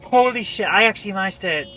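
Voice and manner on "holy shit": breathless disbelief, less loud; shocked exclamation. Real, not acted. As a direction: still rattled, a bit louder and higher than usual. Repeats it after the scream.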